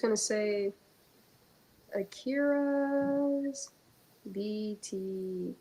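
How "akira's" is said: ordinary talk, nothing marked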